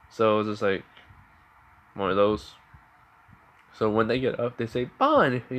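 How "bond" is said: high-pitched call of his name. Acted. They spot Bond and call out, high-pitched call. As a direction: call out sharply high, then falling.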